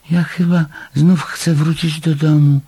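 high-pitched small creature voice; little animal. A narrator's reading. Little animal asking to go home, high small voice.

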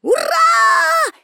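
very high, long shrieked cheer; lone shouted hurrah. A narrator's reading. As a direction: let out one piercing, gleeful shout. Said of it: "тільки один голос вигукнув".

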